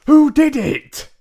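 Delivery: very high, very loud shrieking demand; frantic cry. Acted. Mister Jenkins demanding the culprit, shrieking high cry. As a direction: shriek it like an enraged hotel manager. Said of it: "he cried".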